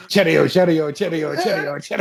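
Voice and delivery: laughing mock British chant of cheerio; singsong cheerio, hammed up. Joining the cheerio chant in mock British. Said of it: "Do your best British accent".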